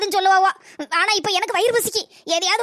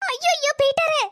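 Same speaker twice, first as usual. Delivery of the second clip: dismayed wail; 7 semitones higher than usual. Acted. Man rides off with bag.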